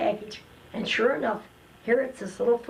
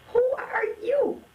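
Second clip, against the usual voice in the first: much higher, slow and outraged; indignant. Retold or imitated. Resenting the husband who slammed the door, slow high scoff. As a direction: scoff at his nerve. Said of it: "And I thought".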